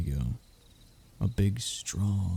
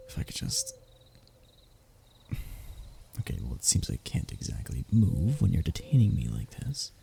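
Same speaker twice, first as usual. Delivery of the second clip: ordinary talk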